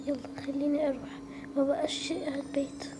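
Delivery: ordinary delivery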